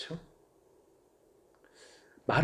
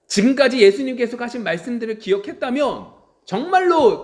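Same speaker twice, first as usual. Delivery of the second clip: loud, high emphatic preaching; fervent preaching; 9 semitones higher, 9 dB louder than usual. Acted. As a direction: press the point hard. (강한 설교 톤)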